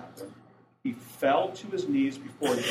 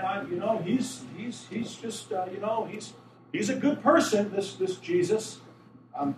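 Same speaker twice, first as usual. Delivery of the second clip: higher, hedging, stumbling voice; mock half-hearted believer; 7 semitones higher, 5 dB louder than usual. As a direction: play someone who won't call him Lord.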